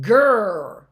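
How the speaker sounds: "grr" loud with a long held R; R sound. Shown as a demonstration. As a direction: stretch out the rrr in "grr". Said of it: "That's the R sound".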